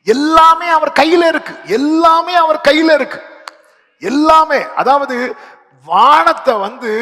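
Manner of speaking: loud, high fervent preaching; preaching at full force. Real, not acted. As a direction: lift the congregation with fervour. (உரத்த உற்சாகமான பிரசங்கம்)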